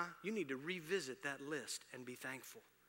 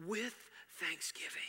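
hushed emphasis; 5 semitones higher than usual. Real, not acted. Pastor whispers the key word to the congregation. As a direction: drop to a whisper on thanksgiving.

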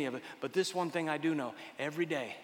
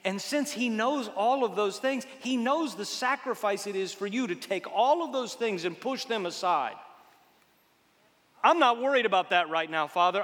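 loud, building preaching, surging higher; building sermon; 8 semitones higher, 9 dB louder than usual. Acted. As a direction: climb toward a full-voiced peak. Preaching at full force.

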